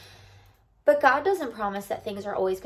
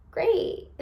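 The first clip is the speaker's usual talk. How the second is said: higher pitched mock-cheerful great; sarcastic great; 10 semitones higher than usual. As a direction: toss off a fake-happy great. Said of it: "And I was like".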